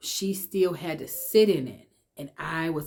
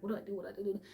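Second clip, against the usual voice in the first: higher but much softer, fretful murmur; hushed panic; 4 semitones higher, 11 dB quieter, much faster than usual. Retold or imitated. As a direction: whisper it into the toy. Clutching her toy, panicking.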